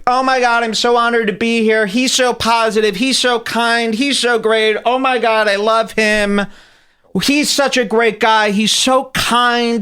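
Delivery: mock a starstruck celebrity gushing. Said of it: "they say the word kind over and over".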